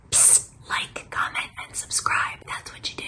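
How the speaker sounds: whispered plug to the audience; psst, whisper it like a secret; conspiratorial whisper. Pretend. Host nudging viewers to like and subscribe, whispered.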